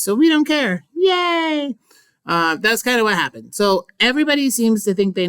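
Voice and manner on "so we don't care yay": mock cheer. Mocking partygoers' gossip.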